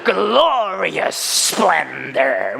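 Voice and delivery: mock grandeur. Mock grand English phrase.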